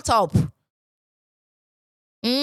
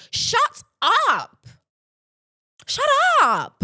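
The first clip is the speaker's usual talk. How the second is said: high, loud, exasperated shut up; shouted shut up; 11 semitones higher, 4 dB louder, slower than usual. Real, not acted. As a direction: yell it at the troll. Ranting at the commenter.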